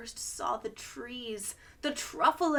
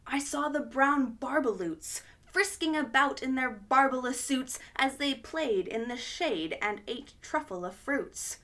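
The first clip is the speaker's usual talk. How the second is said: usual speaking voice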